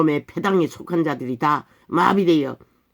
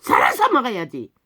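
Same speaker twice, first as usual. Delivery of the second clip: high, urgent pulpit cry; impassioned shout; 7 semitones higher, 4 dB louder than usual. Real, not acted. Preacher urging them to live and tell truth, impassioned shout. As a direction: climb to a shouted demand.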